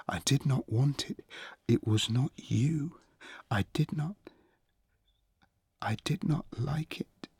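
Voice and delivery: high, breathy, halting near-whisper; ashamed pleading. A narrator's reading. Achilles apologizes to his hurt friend, ashamed and halting. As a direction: falter through it in pauses.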